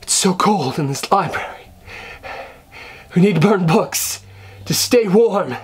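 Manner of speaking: hammed-up freezing movie character; high-pitched, overdone movie melodrama; acting out film scene. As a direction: play someone freezing, badly overacted. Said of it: "act out something from that movie".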